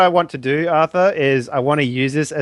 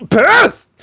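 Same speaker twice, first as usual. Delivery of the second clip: high, loud burst of self-praise; triumphant shout; 8 dB louder than usual. Acted. Player's character congratulates himself, triumphant shout. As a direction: burst out boastfully on one word.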